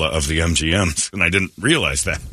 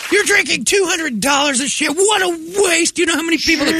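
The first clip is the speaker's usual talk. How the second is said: much higher, hammed-up shouted scolding; mock indignant rant. Imagined rant at lady.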